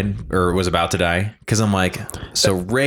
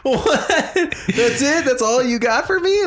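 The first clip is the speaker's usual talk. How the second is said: laughing disbelief, pitched way up; laughing, high-pitched; slower than usual. Real, not acted. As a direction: squeal it through laughter. Laughing at co-host joke.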